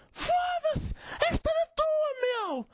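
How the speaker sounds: high, drawn-out mock yell; stretched high-pitched mock protest; mock outburst. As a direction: imitate an indignant kid yelling in a high voice. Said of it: "e ele".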